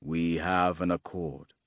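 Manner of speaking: low, slow swordsman's reply; solemn swordsman assenting. A narrator's reading. Swordsman answers, bowing his head.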